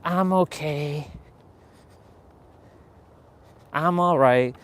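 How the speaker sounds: mock half-hearted, drawn-out reply; holding back. Mocking people who hold back, slow half-hearted answer. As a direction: slowly fake being okay. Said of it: "I don't say".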